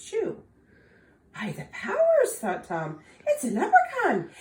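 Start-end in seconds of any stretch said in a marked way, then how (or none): 1.3-2.4 high, loud Irish exclamation; excited Tom. Acted. Tom spotting the leprechaun, exclaiming high and loud. 3.3-4.3 high, loud, with a laugh; gleeful gasp of discovery; excited discovery. Acted. Tom realising he found a leprechaun, high gleeful.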